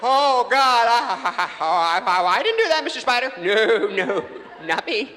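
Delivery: high, loud stammering panic; mock terrified begging. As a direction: stutter and plead for your life. Man begs the giant spider.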